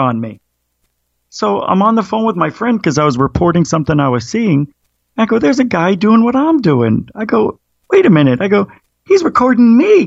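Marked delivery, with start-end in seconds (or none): 1.3-10.0 excited retelling. Retold or imitated. Retelling spotting a camera man.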